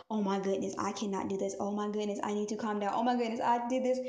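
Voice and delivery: low, soft fretting; talking to oneself. Acted. Speaker acts out anxious self-talk, low and soft. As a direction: keep it down, as if only for yourself. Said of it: "start talking to yourself".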